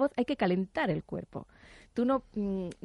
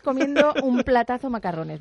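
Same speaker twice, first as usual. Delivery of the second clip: ordinary delivery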